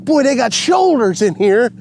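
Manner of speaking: loud, high call; thrilled yell; excited shout. Real, not acted. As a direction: shout it over the water. Fish fighting hard.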